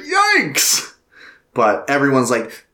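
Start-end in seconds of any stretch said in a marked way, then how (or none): 0.0-0.9 high squeaky yikes; mock yikes. Reacting to a grim film scene, high mock squeal.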